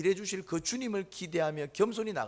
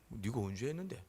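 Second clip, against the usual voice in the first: scornful retort; 5 semitones lower, 6 dB quieter than usual. Retold or imitated. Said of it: "싸우는 사람들 보면은 이렇게 얘기합니다".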